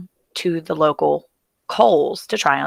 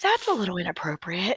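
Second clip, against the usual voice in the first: hushed disapproval; 3 semitones higher, 8 dB quieter than usual. Retold or imitated. Mom's embarrassed reproach in dressing room.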